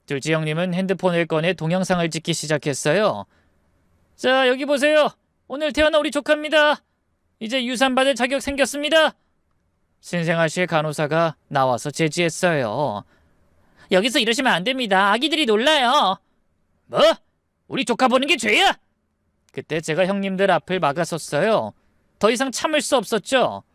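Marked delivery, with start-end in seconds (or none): 4.2-9.2 high, excited presenter delivery; showy announcement. Acted. Second brother filming the newborn nephew, showily excited. Brother narrates his phone video. 13.9-16.2 high-voiced nurse scolding; protesting nurse. Nursery nurse restraining intruding uncles, protesting. 16.8-18.8 high, indignant question; indignant retort. Acted. Uncle stopped by a nurse, indignant.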